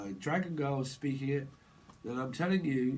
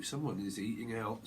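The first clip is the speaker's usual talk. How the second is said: normal talking voice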